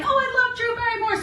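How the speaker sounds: a fan's gasp of delight; delighted gush. Retold or imitated. Seeing Drew Barrymore in the magazine, gushing high. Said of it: "I was like".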